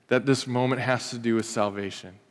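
usual speaking voice

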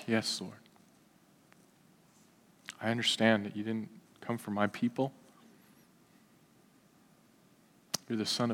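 meek soft-spoken supplicant; humble pleading woman. Acted. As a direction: plead gently, head bowed. Preacher voicing the humble woman.

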